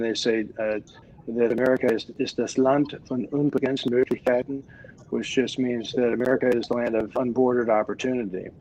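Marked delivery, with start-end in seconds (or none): 2.2-4.6 saying quoted in native German; German pronunciation, said smoothly. Retold or imitated. Quoting the old German saying to the hosts, in German. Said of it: "as the saying goes, in German".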